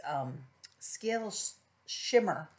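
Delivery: usual speaking voice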